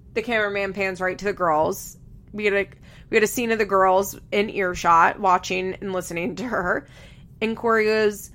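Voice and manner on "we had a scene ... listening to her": ordinary talk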